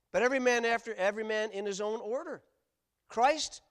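booming scripture proclamation; preached scripture reading. Acted. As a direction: proclaim the verses from the pulpit. Reading scripture at full force.

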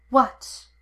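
a startled, harsh What. A narrator's reading. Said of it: "far more harsh than he'd intended".

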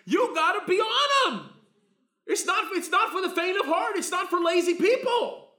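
loud, high, laughing sermon shout; preaching at full force. Acted. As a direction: belt it out with a laugh.

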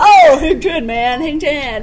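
slow laid-back surfer. Pretend. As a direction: drawl it like a beach surfer. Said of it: "A surfer".

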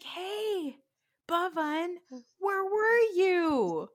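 put-on high, drawn-out little kid voice; slow, high-pitched child's question; little boy. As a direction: imitate a small child calling out. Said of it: "then little Arya shows up".

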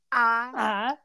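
long Southern opening vowel; Southern accent. Retold or imitated. Person breaking into the quote mid-conversation, Southern accent. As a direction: draw it out like a Southern lawyer. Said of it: "With a Southern accent".

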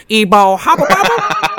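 goofy doodle creature noises; evil doodle gibberish. Retold or imitated. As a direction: chant it like a silly cartoon scribble. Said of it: "running around going".

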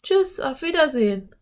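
put-on high girlish goodbye call. A narrator's reading. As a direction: call goodbye across the canyon, high-pitched. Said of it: "rief Anne".

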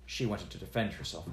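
usual speaking voice

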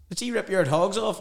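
high, loud mock Brock accent; full Brock accent. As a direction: imitate a friend calling over in broad Brock. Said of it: "full Brock accent".

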